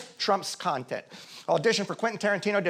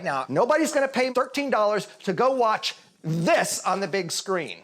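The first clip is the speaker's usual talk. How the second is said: usual speaking voice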